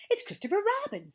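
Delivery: high, loud exclamation; eager. A narrator's reading. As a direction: announce it with eager surprise. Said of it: "said Pooh eagerly".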